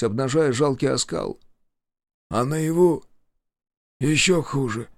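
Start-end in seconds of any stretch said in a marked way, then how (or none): 2.3-4.9 halting, dejected answer; understated, crestfallen explanation; sinking voice. A narrator's reading. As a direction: let the voice sink over a long pause. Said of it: "упавшим голосом объяснил он".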